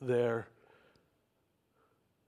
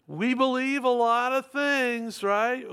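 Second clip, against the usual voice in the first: high, raised preacher's voice; emphatic declaration; 7 semitones higher, 4 dB louder than usual. Real, not acted. Preacher declaring to congregation, emphatic proclamation. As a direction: proclaim it loud and high, stretching it out.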